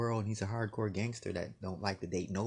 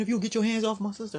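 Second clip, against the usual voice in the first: threatening brother; 12 semitones higher, 5 dB louder, faster than usual. Retold or imitated.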